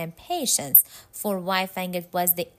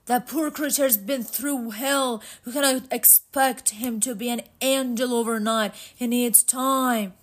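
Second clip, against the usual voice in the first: slightly acted plea, pitched higher; a hint of character voice, higher pitched; lightly voiced Matt, pleading; 4 semitones higher than usual. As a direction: argue earnestly for mercy.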